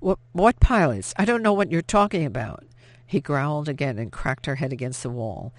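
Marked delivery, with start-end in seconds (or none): 0.0-2.6 higher, louder panicky protest; frightened denial. A narrator's reading. Pinned woman denies everything to her attacker, panicked. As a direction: stammer out the denial, scared.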